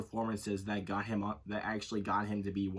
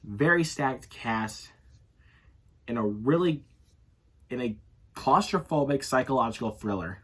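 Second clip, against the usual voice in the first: announcer intro; 2 semitones higher, 8 dB louder than usual. Acted. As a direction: announce the cast with weight. Loud, slow intro of cast.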